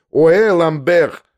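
slow, drawn-out mock howl; retelling a public insult, a mimicked howl; imitating a taunting shout. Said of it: "Стоит передо мной и кричит".

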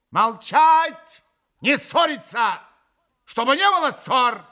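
loud, high, imperious command; крикнула повелительно. A narrator's reading. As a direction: silence the quarrel with sharp orders. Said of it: "крикнула повелительно Грушенька". (громкий властный окрик)

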